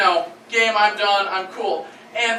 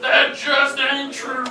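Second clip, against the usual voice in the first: mock noir suspect; 4 semitones higher, much slower than usual. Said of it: "he said".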